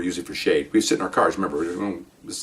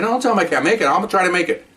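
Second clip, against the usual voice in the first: high, agitated retort; agitated protest; 10 semitones higher than usual. Retold or imitated. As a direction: protest urgently, pitch way up. Arguing with boss on phone.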